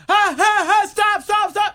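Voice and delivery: high loud laughing stop stop; panicked laughing yell. Retold or imitated. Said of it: "I'm going".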